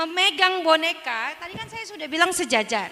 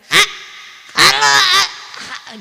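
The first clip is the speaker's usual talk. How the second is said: fumbling puppet voice; 4 semitones higher, 7 dB louder, slower than usual. Shown as a demonstration. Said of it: "Kok nggak bisa sih?".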